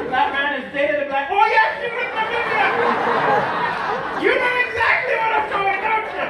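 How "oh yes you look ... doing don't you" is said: higher, loud excited recognition; woman's voice. Pretend. Woman in bed to the man, loud excited recognition. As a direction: cry it out as she spots him.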